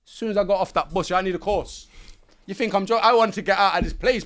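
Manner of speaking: high, loud urgent pleading; pushing hard to get out; desperate. Retold or imitated.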